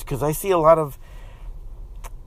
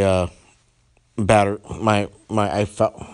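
normal talking voice

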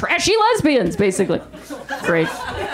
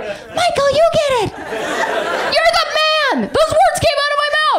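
high, slow, laughing hype-up; mock cowardly cheer; 13 semitones higher than usual. Comic urging her friend's husband to face the neighbor, high mock cheer. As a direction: push the blame on him with fake praise. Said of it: "I was like".

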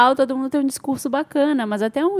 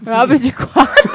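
laughing echo; 3 semitones higher, 8 dB louder than usual. Real, not acted. Repeating a dirty pun.

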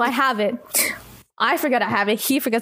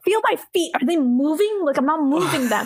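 higher-pitched mock alarm; acted paranoia; 4 semitones higher than usual. Telling friends to feel their feet, acted alarm. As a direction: replay your own paranoid worry. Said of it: "I was fucking paranoid as shit".

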